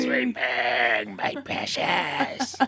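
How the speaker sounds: hissing little gremlin voice. Acted. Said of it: "the little gremlin voice that you do".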